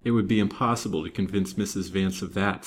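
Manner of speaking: usual speaking voice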